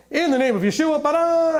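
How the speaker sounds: flourish it like a magician's spell; hammed-up abracadabra on the name; mock magic incantation. Mocking believers who treat the name as a magic spell, hammed-up. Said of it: "It's like magic".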